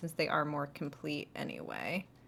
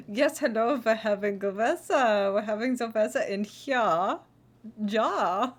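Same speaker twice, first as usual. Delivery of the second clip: lightly put-on German accent, a bit louder; playful fake German, ending on a German "Ja"; mock German accent; 3 semitones higher, 8 dB louder than usual. Streamer jokes about the weather in a German accent. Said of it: "Not a bad accent impression".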